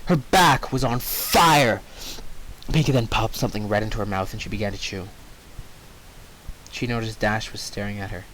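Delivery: usual speaking voice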